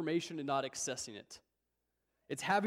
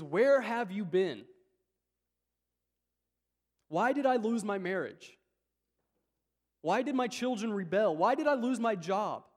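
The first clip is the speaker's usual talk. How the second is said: pleading questions to God; 4 semitones higher, 7 dB louder than usual. Retold or imitated. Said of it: "our real question to God is".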